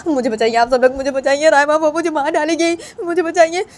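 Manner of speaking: high, loud panicked begging; frightened plea for help. Acted. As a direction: beg everyone to save you from Raima. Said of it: "तो बानी वजह से बोलती है". (डरी हुई ऊँची आवाज़ में गुहार)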